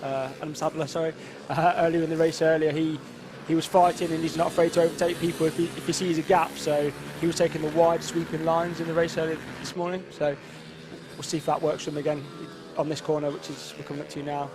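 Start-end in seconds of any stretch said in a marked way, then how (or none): none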